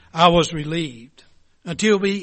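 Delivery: normal talking voice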